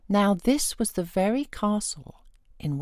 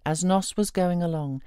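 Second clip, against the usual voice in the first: usual speaking voice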